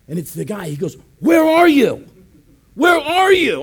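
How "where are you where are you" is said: high, loud phone shout; imitated alarmed demand. Guard phoning the pastor who wandered off, shouted demand. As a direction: yell it like a frantic minder.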